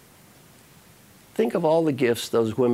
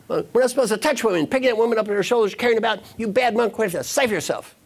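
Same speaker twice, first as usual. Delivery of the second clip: fast, high-pitched mock tirade; hammed-up scolding in a rush; angry monk; 10 semitones higher, faster than usual. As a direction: rattle off an indignant scolding. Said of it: "The other monk is angry".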